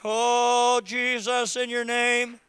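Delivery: loud, high, impassioned preaching cry; preaching at full force. Acted. Pastor urging the congregation to worship, shouting fervently. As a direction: rouse the whole church to worship.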